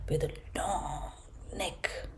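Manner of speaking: soft whisper; whispered aside. A narrator's reading. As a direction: whisper it as a secret. Describing the giraffe.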